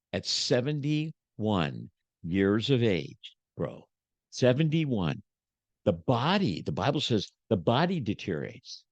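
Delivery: normal talking voice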